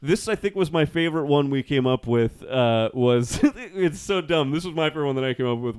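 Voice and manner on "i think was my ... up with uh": usual speaking voice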